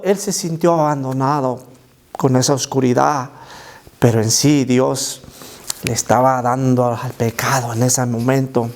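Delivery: usual speaking voice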